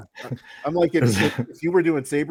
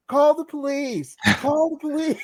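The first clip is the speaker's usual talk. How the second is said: panicky worker; 12 semitones higher than usual. Retold or imitated. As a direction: mimic a frantic employee. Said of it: "the guy's like".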